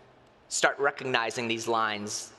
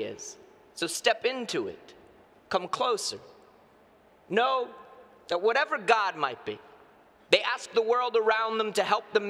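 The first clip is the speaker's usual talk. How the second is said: performed poem, higher than chatting voice; poem recitation; 9 semitones higher than usual. Acted. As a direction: recite it as verse, each phrase set apart and falling at the end. Recites his poem on stage.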